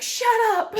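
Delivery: high, squealed mock scream; retold scream. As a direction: squeal it back at the nagging pacer. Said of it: "I literally screamed, I was like".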